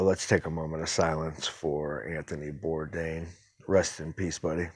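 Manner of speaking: low, hushed and subdued; quiet, respectful farewell; solemn tribute. Real, not acted. Host leads a moment of silence for Anthony Bourdain, solemn.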